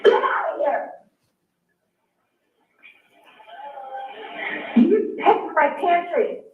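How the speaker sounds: real upset at the ex in her pantry; angry confronting. Real, not acted.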